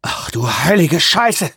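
langsam, fassungslos herausgepresst; hervorgestoßen. Acted. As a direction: lass den Schreck langsam heraus. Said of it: "stieß er hervor". (fassungslos hervorgestoßen)

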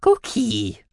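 high little creature voice; high-pitched cartoon animal; mouse-beaver voice. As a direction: prompt helpfully in a squeaky voice. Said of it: "half der Mausbiber".

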